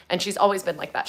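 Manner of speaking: normal talking voice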